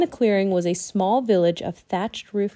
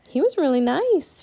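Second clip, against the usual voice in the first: put-on high, sweet child voice; pleased girl; 7 semitones higher than usual. A narrator's reading. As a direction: say it fondly as a little girl. Annie, a child, smiles.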